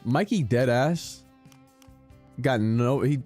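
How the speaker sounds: usual speaking voice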